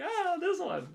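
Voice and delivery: high-pitched imitation of a pet's reaction; voicing the dog. Owner voicing Peach picking a hand, high imitated dog voice. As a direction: jump up high as the dog choosing. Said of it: "And she's like".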